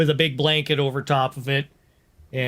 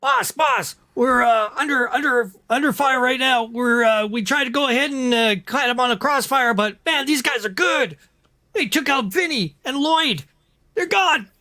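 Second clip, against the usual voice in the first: higher, urgent radio report; panicked henchman; 7 semitones higher than usual. Acted. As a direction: report in under fire, high. GM voices panicked henchman on comm.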